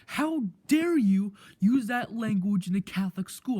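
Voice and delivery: loud indignant mock scolding; imitated yell. Teacher yelling in a student's face, imitated loudly. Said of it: "full out just yells at me in my face".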